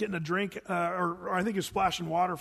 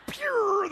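high, drawn-out zipping sound; comic zoom-away effect; whooshing getaway noise. Retold or imitated. Preacher showing guides fleeing back into jungle, high whoosh.

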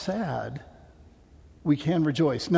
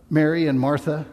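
ordinary talk

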